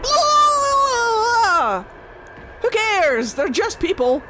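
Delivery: high, loud mocking boast; pompous gods. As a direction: puff up like arrogant gods. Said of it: "the Evan Uris were like".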